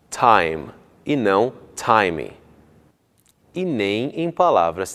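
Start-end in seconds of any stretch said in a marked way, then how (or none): 0.1-2.3 "time" clean, then "time" said "timi"; slow contrast of "time" and "timi"; right then wrong. Shown as a demonstration.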